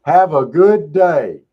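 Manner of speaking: loud, slow, sing-song farewell; drawn-out, over-sweet goodbye to the cop; mock cheerful goodbye. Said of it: "that's what you tell 'em".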